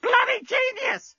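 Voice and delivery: very high, slow squawking parrot. A narrator's reading. Parrot answering the captain, squawking very high. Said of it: "squawked the parrot".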